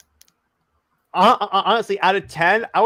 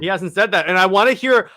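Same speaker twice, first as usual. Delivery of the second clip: normal talking voice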